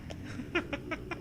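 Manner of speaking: high airless laugh; breathless silent laugh. Real, not acted. Co-host laughing breathlessly at the reading. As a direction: wheeze out a laugh.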